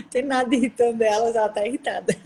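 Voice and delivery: amused, words riding on a laugh. Real, not acted. Co-host joining the joke about irritated women, laughing. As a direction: say it through laughter.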